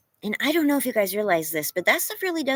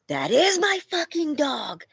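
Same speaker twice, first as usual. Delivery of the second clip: slow, higher, shocked mock outburst; freaked-out curse; 6 semitones higher, slower than usual. Owner spotting her dog in the street, imitating the panicked curse. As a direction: retell the panic at seeing the dog loose. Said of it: "I was just so freaked out".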